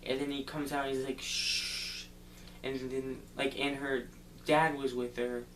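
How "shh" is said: long breathy "shh"; imitated hushing noise; shushing. Pete coming out of the cave, shushing.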